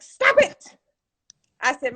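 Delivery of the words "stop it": high, loud imitated scolding of the attendant; sharp command. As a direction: bark it like a stern attendant stopping you. Spa attendant snapping at her.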